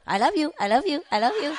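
high, quick sing-out of a pet bird; bird's love plea. Retold or imitated. Bird at the owner's shoulder begging for peanuts, high-pitched imitation. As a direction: imitate a parrot sweet-talking for treats. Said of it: "he pick his head right away".

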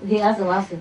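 normal talking voice

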